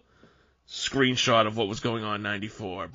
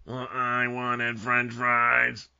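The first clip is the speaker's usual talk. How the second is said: put-on slow, sulky kid whine; mock sulky child; whiny child; much slower than usual. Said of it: "doing The Undertaker and Kane as children".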